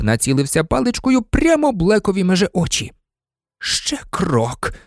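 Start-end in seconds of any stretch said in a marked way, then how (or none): 3.6-4.7 tense, restrained warning; hissed threat. A narrator's reading. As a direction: hiss it as a threat. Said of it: "прошепів він".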